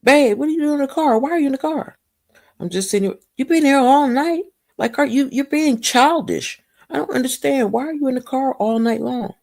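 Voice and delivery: a hint of a puzzled husband. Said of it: "He was like".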